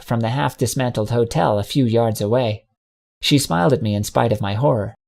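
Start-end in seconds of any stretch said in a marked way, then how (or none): none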